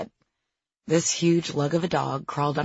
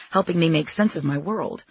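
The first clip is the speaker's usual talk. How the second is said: ordinary delivery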